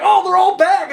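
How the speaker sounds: high-pitched imitation of fans; mock excited fans. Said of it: "They're like".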